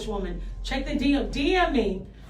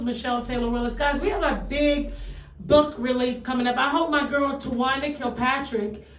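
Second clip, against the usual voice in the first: normal talking voice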